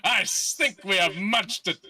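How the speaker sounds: lightly voiced pirate host; pirate captain voice. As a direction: greet them like a theatrical captain. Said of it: "And he goes".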